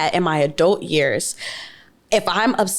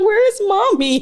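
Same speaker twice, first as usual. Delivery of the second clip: put-on crying kid; 13 semitones higher, slower than usual. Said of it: "that kid is still crying".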